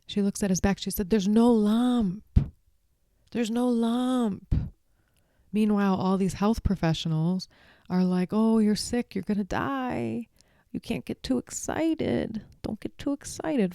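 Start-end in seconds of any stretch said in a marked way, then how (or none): 1.1-4.7 slow, weighted NO; firm reassurance. Acted. Mary telling Colin firmly, slowly there's no lump. 8.3-13.7 mocking fun police. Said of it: "Fun police".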